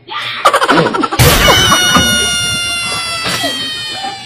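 a high shriek of laughter breaks out; cracking up at the skit; high laughing shriek. Real, not acted. Laughing at the fake quarrel.

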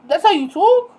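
loud, high-pitched challenge rising at the end; incredulous retort. Retold or imitated. She challenges his excuse for talking that way, loudly incredulous. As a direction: throw it back at him in disbelief.

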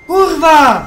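shouting. Real, not acted. Raging at game GPS route.

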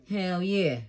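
normal talking voice

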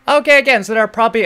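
a bright, loud, high "okay" to kick things off; excited. Real, not acted. Host to viewers, starting the reaction, excited and loud. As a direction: pump up the start of the video.

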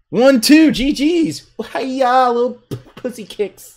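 high, squeaky mock fighting cries; playful taunt. Player finishing opponent with little kicks, playful high taunt. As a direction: chirp it high, starting loud. Mocking with tiny winning kicks.

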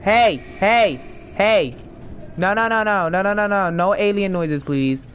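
loud, high panicked yells; panicked shouting. Real, not acted. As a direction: yell at the alien in panic. Panicking as the alien approaches.

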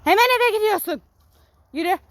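high, loud command; stern loud order. Real, not acted. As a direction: order the dog home. Ordering the dog home.

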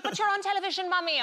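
put-on high, insistent little-kid voice; protesting child. Daughter arguing with her famous mummy, high protesting voice.

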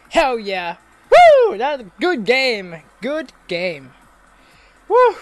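high, loud whooping cheers; victory whoops. Real, not acted. Gamer celebrating his team winning. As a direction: cheer like you just won the final.

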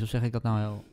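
ordinary delivery

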